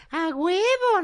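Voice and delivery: very high squeaky kitten voice; high little kitten exclaiming; baby kitten. Acted. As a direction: chirp it like an eager baby cat.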